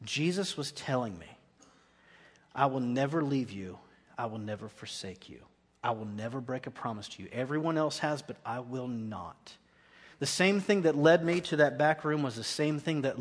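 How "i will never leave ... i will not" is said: softer, lower, slowing at the end; tender promise. Retold or imitated. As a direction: speak it gently as a sacred vow. Jesus's promise, voiced softly.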